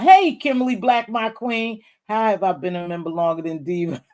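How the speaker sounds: ordinary talk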